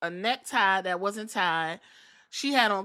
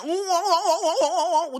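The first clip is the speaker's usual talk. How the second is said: high, louder gleeful ooh chant; high excited cooing; 9 semitones higher, 6 dB louder than usual. Retold or imitated. Io cheering from the corner, high gleeful ooh chant. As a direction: coo with glee, higher and louder.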